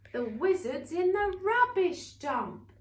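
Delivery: high, loud gleeful line; gleeful announcement. Acted. Storyteller telling children the wizard landed in the dump, gleeful. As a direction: crow over the villain's fall.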